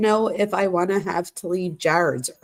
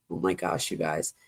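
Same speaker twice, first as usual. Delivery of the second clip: exasperated aside; 13 semitones lower, 7 dB quieter than usual. Real, not acted. Aside to viewers, reading texts.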